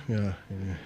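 normal talking voice

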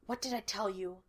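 a quiet conspiratorial murmur; whispered. A narrator's reading. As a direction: keep it down so nobody overhears. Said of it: "Sean whispered".

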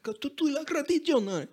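high, put-on Italian accent; mock Italian Pope voice. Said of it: "He's like".

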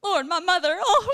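high mock wail; hammed-up panic. Preacher parodies fearful prayer, high mock wail. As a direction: keep wailing in mock terror.

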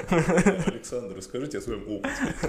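mock-formal question through laughter; laughing. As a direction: laugh while playing interviewer. Jokingly interviewing co-host. (смеясь, шутливо-официально)

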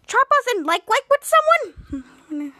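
high, loud incredulous question; shocked disbelief. Retold or imitated. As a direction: exclaim it as astonishing gossip. Shadow reacts to shocking crush news.

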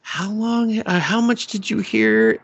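sheepish and anxious; lightly voiced flustered guy; nervous boy. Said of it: "he's just like".

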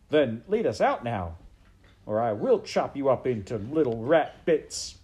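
loud, punchy threat; threatening serving girl. Acted. Maid threatening to chop up the rat, loud defiance. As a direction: threaten him with blunt force.

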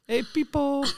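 squeaky high call of Peepo; high mock greeting. Friend greeting Peepo, high squeaky call. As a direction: sing it out high like a buddy. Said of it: "That's what his friends call him".

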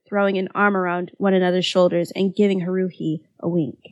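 ordinary delivery

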